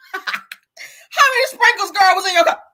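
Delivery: slow, high, loud and laughing; laughing high question. Pretend. A mock lawyer asks the witness, laughing high and slow. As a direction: ask it up high, cracking up.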